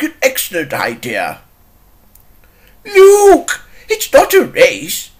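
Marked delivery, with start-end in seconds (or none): 2.8-5.1 loud, high protest; exclaimed reproof. Acted. Telling an engine it is not racing, exclaimed reproof. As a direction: cry out to slow someone down.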